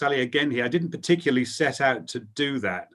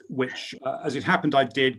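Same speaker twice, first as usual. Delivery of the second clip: ordinary talk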